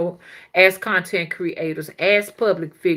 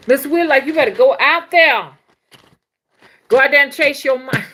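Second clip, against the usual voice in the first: higher, louder teasing call-out; playful call; 6 semitones higher, 8 dB louder than usual. Real, not acted. To Mister Will on stream, a laughing playful shout. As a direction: shout him out with a grin.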